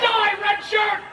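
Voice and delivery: high, loud theatrical order; shouted command. Acted. Showman orders volunteer in red shirt to die, shouted. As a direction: shout it out like a stage death sentence.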